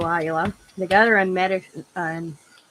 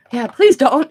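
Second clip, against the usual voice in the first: higher, louder pleading; mock plea; 6 semitones higher, 12 dB louder than usual. Granddaughter begging grandma not to tell, mock plea. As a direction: beg her not to, half joking. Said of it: "She goes".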